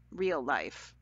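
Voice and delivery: ordinary delivery, nothing marked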